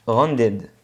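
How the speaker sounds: English-accented Rounded; English word. Shown as a demonstration. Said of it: "pour mon accent anglais pas terrible".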